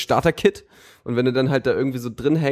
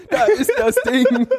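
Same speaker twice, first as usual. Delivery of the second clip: high, loud goal scream with laughter; screaming goal commentary; 11 semitones higher, 5 dB louder than usual. Pretend. Commentator moment at the toilet.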